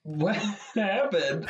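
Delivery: high, slow question through laughter; real amused laughter breaking the words; laughing. Real, not acted. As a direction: ask it while cracking up. Laughing at failed voice attempt.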